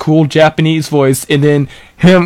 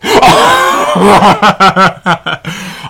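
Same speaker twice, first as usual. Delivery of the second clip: sudden high cackling laugh; howling laugh; 6 semitones higher than usual. Real, not acted. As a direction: explode into laughter. Bursts out at Death Note.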